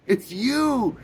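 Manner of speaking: high, mock-tearful cry; imitated weeping. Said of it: "she's weeping like".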